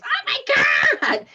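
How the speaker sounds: high, loud shocked cry; intense outburst. Retold or imitated. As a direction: blurt it out like a startled dog's thought. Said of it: "thoughts very intense".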